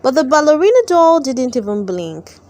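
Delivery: usual speaking voice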